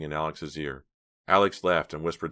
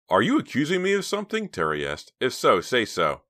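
challenging; 8 semitones higher, 5 dB louder than usual. A narrator's reading. Terry confronts Alex.

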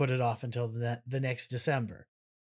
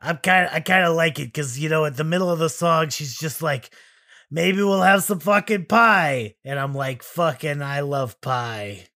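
loud, surly elf ranting; a touch of bitter elf; grumpy elf; 2 semitones higher, 7 dB louder than usual. Acted. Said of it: "begrudged, laid off elf".

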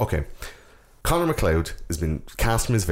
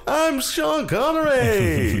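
very high, slow mocking voice; bad Connery double; much slower than usual. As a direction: squeak it out like a cheap impostor. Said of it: "some guy with a beard stuck to his face going".

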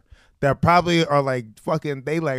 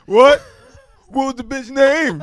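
higher, louder startled what; mock surprise; 9 semitones higher, 5 dB louder than usual. Host imitates himself asking for names, higher and louder. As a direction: act startled and nosy. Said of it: "And I'm like".

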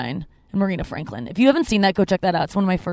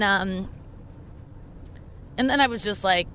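ordinary delivery

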